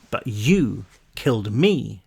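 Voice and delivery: eerie slow accusation. A narrator's reading.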